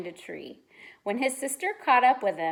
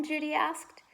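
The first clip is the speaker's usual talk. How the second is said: ordinary delivery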